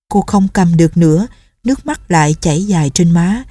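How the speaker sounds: plain reading voice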